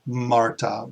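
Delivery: usual speaking voice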